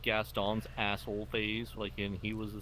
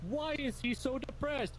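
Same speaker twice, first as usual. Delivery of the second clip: high mock voice; 14 semitones higher, 11 dB louder than usual. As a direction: squeak out the confused questions. Mocking cartoon characters after time skip.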